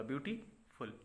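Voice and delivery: Beautiful with a clear break before फुल; beautiful demonstrated as two separate pieces; split in two parts. Shown as a demonstration. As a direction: pause between beauty and फुल. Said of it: "दो बार में इसको बोला".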